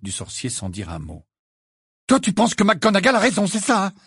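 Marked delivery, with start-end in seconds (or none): 2.1-3.9 high, loud accusing question; heated, raised-voice accusation; angry challenge. A narrator's reading. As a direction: challenge a friend angrily. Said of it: "dit Harry avec colère".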